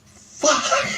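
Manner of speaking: laughing shout at his bloody face in the mirror; shocked shout. Retold or imitated.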